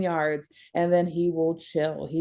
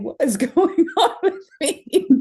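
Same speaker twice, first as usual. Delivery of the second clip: high, laughing mock bewilderment; laughing disbelief; 11 semitones higher, 4 dB louder than usual. Pregnant woman laughs at her own tears, high and giggly. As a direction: laugh through it, pitched up high. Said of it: "I'm like".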